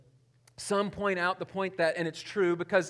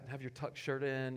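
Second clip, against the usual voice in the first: usual speaking voice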